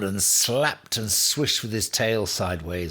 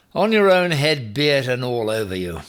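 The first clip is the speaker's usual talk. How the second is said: loud, higher, stern nurse giving up on him; stern rebuke; 5 semitones higher, 8 dB louder than usual. Acted. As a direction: scold him loudly, final word. Big Nurse washes her hands.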